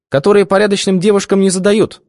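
high, lofty and grand; haughty reply. A narrator's reading. Svetka answering the boss, haughty reply. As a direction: answer with mock dignity. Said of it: "величественно ответила она".

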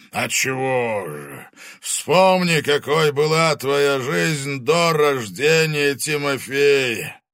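loud, slow, high booming dragon; menacing fire dragon voice; booming arrogant dragon. Acted. Dragon lectures Angelina.

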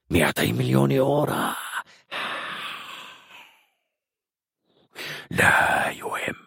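whispered, rasping villain voice; cold, breathy threat from a cruel captain; menacing commander. Acted. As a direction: cut the prisoner off in a sinister whisper.

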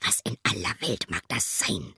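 leise geflüsterte Frage; flüsternd zu sich. Acted. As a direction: gierig vor sich hin flüstern. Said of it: "fragte er sich". (leises Selbstgespräch flüsternd)

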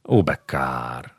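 slow, low, eerie mocking drawl; mock pity, low and slow; sinister prince. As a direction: savour each word like a predator. Prince mocks mother, slow eerie.

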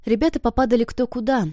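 ordinary delivery

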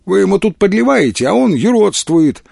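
high-pitched indignant complaint. A narrator's reading. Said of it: "возмутилась Вера". (возмущённо, на повышенном тоне)

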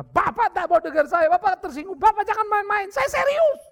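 high, loud, indignant, rising to the end; angry protest. Retold or imitated. Wife angry at the pastor for not taking her seriously, high loud protest. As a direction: snap back at the pastor. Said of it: "Dia marah".

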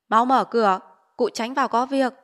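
loud, urgent call from outside the gate; calling through the gate. Acted. Village official's men demanding the gate opened, loud call. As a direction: bark it through the closed gate to get it opened.

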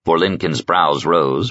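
usual speaking voice

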